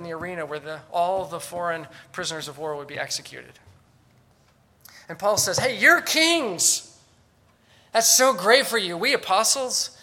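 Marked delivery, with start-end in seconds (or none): 5.6-9.9 high, loud mock congratulations; sarcastic. Apostle Paul to the would-be kings, sarcastically congratulating. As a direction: cheer them with exaggerated false delight. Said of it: "his voice dripping with sarcasm".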